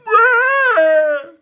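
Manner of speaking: very high loud bawl; high bawling child; wailing howl. A narrator's reading. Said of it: "cried Dawn".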